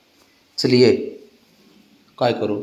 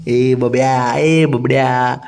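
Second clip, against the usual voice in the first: coaxing call; 2 semitones lower, much slower than usual. Real, not acted. Coaxing the pet by nicknames.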